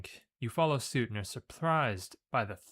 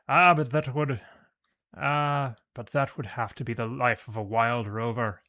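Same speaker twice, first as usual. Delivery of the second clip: put-on a drunk Irishman sighing over his lot, much louder; wistful Irish brogue; 7 dB louder than usual. Giant waving at his impounded ship, wistful in a loud Irish brogue. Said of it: "he says wistfully".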